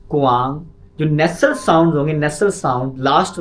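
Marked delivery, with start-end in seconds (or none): 0.1-0.6 कुआंग said loud with a rising end; pronunciation demo. Shown as a demonstration. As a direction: stress the nasal end of कुआंग. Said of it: "देखें ये फ़र्क आएगा आपको". (कुआंग का उच्चारण ज़ोर से दिखाना)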